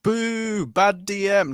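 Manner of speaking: jokingly boo the DM; mock booing. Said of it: "No, I'm joking".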